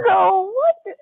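high-pitched disbelief, rising sharply; incredulous. Real, not acted. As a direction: react in real astonishment, voice shooting up. Incredulous reaction to Fargo.

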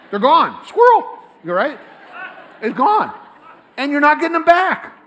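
high, loud shouted punchline; loud comic burst. Acted. Speaker to audience about distracted kids, loud high comic shout. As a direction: blast it for laughs. Mocking short attention spans.

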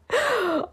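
high, melodramatic gasp; mock gasp. Reader mocks Marshall's shock, gasping. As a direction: gasp as in a soap opera.

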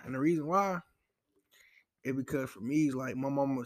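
ordinary delivery

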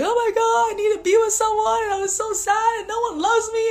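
high, loud, whiny sobbing voice; childish tantrum wail. Retold or imitated. Imitating her own panic-attack self, a high whiny tantrum. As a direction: wail it like a little kid on the floor. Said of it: "having a tantrum like a little kid".

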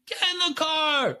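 put-on sharp high command to the kids. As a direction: bark it like a parent who means business. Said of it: "I just raised my voice and I said".

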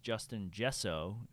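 ordinary talk, nothing marked

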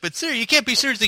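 high, fast fussy voice; C-3PO voice. Retold or imitated. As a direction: fuss like a nervous robot butler. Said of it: "He's like".

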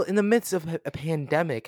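ordinary delivery

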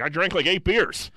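can't believe he passed the test; disbelieving. Retold or imitated. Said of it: "He was like".

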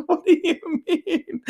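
high, slow question through laughter; laughing question. Retold or imitated. To worried Brittany, a high giggling what do you mean. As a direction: ask it while cracking up. Laughing at her sleep warning.

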